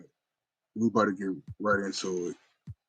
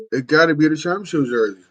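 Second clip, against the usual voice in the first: higher and louder, decided; excited pick; 7 semitones higher, 11 dB louder than usual. Real, not acted. Gamer choosing jerseys for viewers, excited and sure. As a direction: land the choice with a burst.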